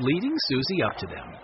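plain reading voice

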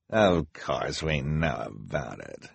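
low, slow, menacing snarl. A narrator's reading. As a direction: snarl it softly, dragging the words. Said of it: "she snarled".

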